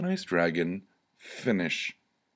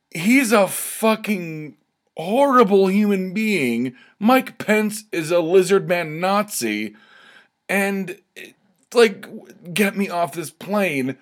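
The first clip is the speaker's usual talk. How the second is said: high, slowed, hammed-up outrage; mock rant; 10 semitones higher, slower than usual. Answering Trump fans, high slow hammed-up rant. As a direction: rant back in a strained high voice. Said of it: "And I'm gonna be like".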